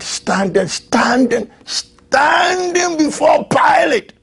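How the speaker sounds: climbing, crying preacher's wail on standing; rising preaching cry. Acted. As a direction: build the repetition to a wailing shout.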